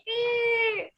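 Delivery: high-pitched squeal of scratched chalkboard; thin, high imitated screech; blackboard screech. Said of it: "scratches the, um, the blackboard and you hear that".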